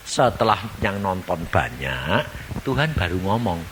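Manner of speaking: usual speaking voice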